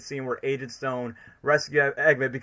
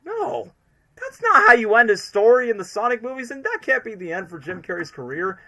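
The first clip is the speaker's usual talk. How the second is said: exasperated protest; 7 semitones higher, 4 dB louder than usual. Retold or imitated. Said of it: "I'm like".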